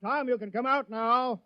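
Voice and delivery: high, loud call across the village; shouting for Tom, drawing out the last call. Acted. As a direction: call him out of hiding. Mayor calls the hiding man out.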